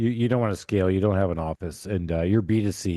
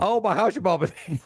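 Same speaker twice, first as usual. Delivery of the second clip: high, hammed-up Southern accent; overdone Southern sympathy; mock Southern gushing; 10 semitones higher than usual. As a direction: parody a chatty Southern receptionist. Parodies Southern phone agent.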